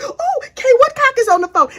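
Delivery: a hint of a flustered agent; mock alarmed FBI. FBI agents startled that Kay is calling, lightly voiced. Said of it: "the F B I was like".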